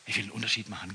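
very soft, low prayer; quiet prayer. Acted. Preacher drops to prayer.

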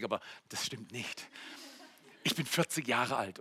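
ordinary talk

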